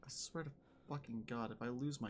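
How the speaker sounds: hushed. Real, not acted. Tense moment in horror game.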